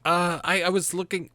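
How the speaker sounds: high, hesitant interjection; half-elf objecting. Acted. Half-elf tries to stop departing barkeep, high hesitant protest. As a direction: try to break in, high and halting. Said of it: "the half-elf's like".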